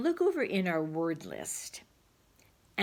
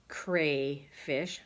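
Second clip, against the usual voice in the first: slow sounding out; 2 semitones lower than usual. Shown as a demonstration. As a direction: stretch like crayfish very slowly. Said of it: "very slow sounding out".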